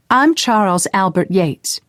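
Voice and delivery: put-on a girl proudly puffing herself up; Kay's voice. A narrator's reading. Kay voiced, posing as boy.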